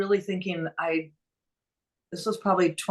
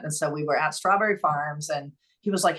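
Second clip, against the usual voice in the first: usual speaking voice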